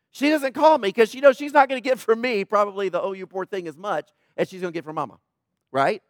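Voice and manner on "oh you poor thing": a soft, hushed "Oh, you poor thing"; cooing like a comforting mother; mom's sympathy. Retold or imitated. Mom comforting a sick daughter.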